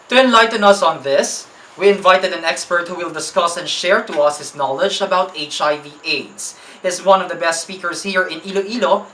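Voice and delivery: loud, upbeat announcer welcome; radio host introduction. Acted. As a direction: present the guest like a show host. Host introduces guest on show.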